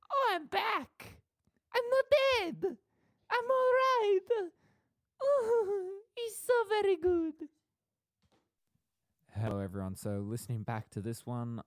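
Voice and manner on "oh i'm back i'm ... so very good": slow, very high squeal of relief; drawn-out squeaky creature voice, loud and elated; Mort from Madagascar. Acted. Rider back alive from the roller coaster, relieved squealing. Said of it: "started doing Mort from Madagascar".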